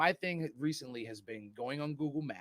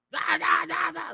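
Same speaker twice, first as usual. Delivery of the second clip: high, loud mock Italian shouting; exaggerated Italian yammering; 11 dB louder, slower than usual. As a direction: bark out nonsense like an over-the-top Italian. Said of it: "they're already exa".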